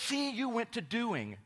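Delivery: high, loud, slow smug correction; mock know-it-all. Trendy friend correcting the pastor, high smug mock voice. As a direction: catch him out triumphantly. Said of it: "He goes".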